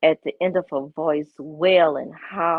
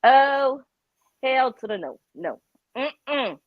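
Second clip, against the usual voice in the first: no way; 6 semitones higher, slower than usual. Retold or imitated. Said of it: "I was like".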